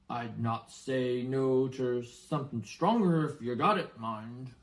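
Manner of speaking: lightly voiced sly giant; a wink in the voice; Hagrid. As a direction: giant hinting for a drink, sly. Hagrid hints at stronger drink.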